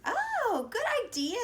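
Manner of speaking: high, bright "good idea"; light up with delight. A narrator's reading. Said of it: "said Red".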